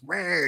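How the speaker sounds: high drawn-out animal noise; mock rabbit snarl. Reader imitating a rabbit going at a passer-by, high mock snarl. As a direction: pitch it way up, a little creature's snarl. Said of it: "there's this rabbit that goes".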